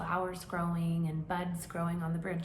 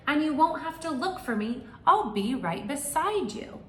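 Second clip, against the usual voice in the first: bird voice; 7 semitones higher, 7 dB louder than usual. Acted.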